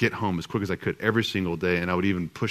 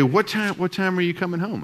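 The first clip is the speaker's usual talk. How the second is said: higher, light mock of the wife asking; wife's way of asking; 9 semitones higher than usual. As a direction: echo her rising, sing-song question. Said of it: "that's the way she'd say it".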